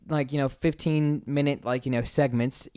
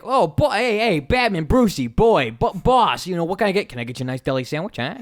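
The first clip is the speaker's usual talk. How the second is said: put-on Brooklyn kid; 3 semitones higher than usual. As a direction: sell him a sandwich like a Brooklyn kid. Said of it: "he's like some kid from Brooklyn".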